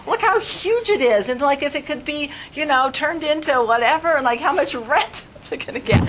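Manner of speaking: a son-in-law excitedly sizing up the rent. Retold or imitated. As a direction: gush about the profit.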